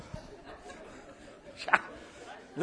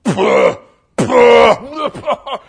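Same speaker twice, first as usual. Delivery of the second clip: exaggerated dismissive "puh"; hammed-up scoff; loud scoffing puffs; 5 semitones higher, 16 dB louder, much slower than usual. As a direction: puff out mock indignation. Peter scoffs, acted.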